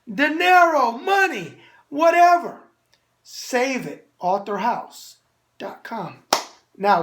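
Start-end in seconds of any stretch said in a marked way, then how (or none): 0.1-2.6 punchy. Real, not acted. Punchline listing slang.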